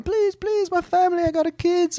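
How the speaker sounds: very high mock Italian pleading; pleading Italian accent. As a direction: beg for the job in a squeaky Italian accent. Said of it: "he's going".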